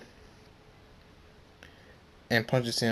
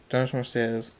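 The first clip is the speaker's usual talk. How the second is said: ordinary delivery, nothing marked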